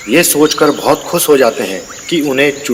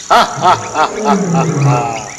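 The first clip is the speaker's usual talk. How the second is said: evil cackle; slower than usual. Acted. Demon gloats after threat.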